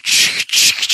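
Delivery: breathy unvoiced ch ch of a steam engine; train chugging. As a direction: puff like a locomotive. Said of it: "you heard the ch".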